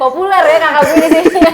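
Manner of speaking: high, loud laughing quip; laughing tease. Real, not acted. Laughing that her sister keeps appearing in photos. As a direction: laugh it out as a joke.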